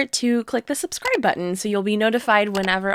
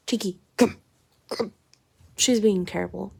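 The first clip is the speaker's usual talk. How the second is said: normal talking voice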